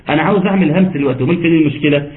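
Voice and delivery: ordinary delivery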